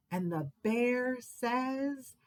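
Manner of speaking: ordinary delivery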